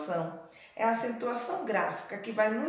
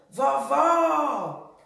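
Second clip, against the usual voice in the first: "vovó" high and loud, final "vó" held long; "vovó" called out on a long last syllable; stretched stressed syllable; 4 semitones higher, 5 dB louder than usual. Shown as a demonstration. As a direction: draw out the second syllable of "vovó". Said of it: "que ficou mais longa".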